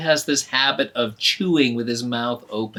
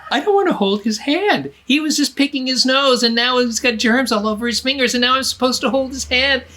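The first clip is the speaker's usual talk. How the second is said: put-on much higher, louder childish protest; whiny little girl; 9 semitones higher, 6 dB louder than usual. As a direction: whine like a disgusted kid. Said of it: "She's like".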